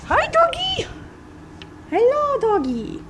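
put-on high cooing baby talk to a dog. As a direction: coo at the doggy.

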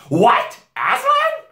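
shocked, high, loud queen; witch crying out. A narrator's reading. Queen hearing of Aslan, crying out. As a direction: cry it out in high alarm. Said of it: "cried the Queen".

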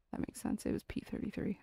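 whispering to herself, unvoiced; whispered aside. Real, not acted. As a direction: murmur it under breath as an aside. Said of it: "quietly muttering to self".

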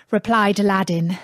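ordinary delivery, nothing marked

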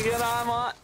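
a hammed-up Aussie "g'day, mate"; mock Australian. Greets viewer Aussie style.

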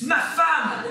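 loud, high exasperated outburst. Acted. Exasperated reminder of who is meant. (exaspéré, en criant)

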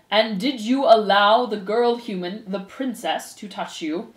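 slow, pompous, formal pronouncement; stern head mouse. Acted. Head mouse puts rumors to the accused, stern and formal. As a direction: preside with weighty, deliberate authority.

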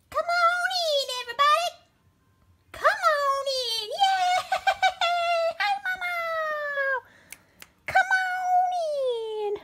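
very high, loud sing-song call; excited pet greeting. Real, not acted. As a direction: call the pets in with glee. Welcoming pets into the closet.

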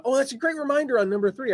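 high, quick burst of recognition; acted pleased realization. Returning reader rediscovering an old post, pleasantly surprised. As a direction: play a reader delighted to be reminded.

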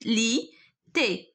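لي، تي as two isolated syllables; syllable-by-syllable dictation. Shown as a demonstration. Teacher finishing the word on the board, syllable by syllable. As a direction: leave a clear pause between لي and تي.

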